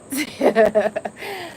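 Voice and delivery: bursts into a short rising laugh; a real laugh that rises at the end. Real, not acted. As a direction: laugh at your own discovery. Amused at her own discovery.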